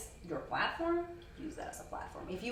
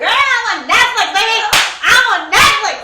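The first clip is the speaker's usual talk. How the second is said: loud, high, gleeful shout; excited shouting; 14 semitones higher, 23 dB louder than usual. Real, not acted. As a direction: shout it out with glee. Excited about being on Netflix.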